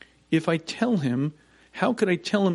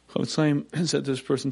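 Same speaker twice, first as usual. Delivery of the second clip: ordinary delivery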